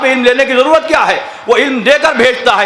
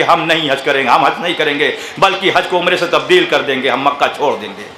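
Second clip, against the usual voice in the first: hushed grieving voice of the Imam; 8 semitones lower, 4 dB quieter than usual. Acted. Imam's declaration voiced in narration.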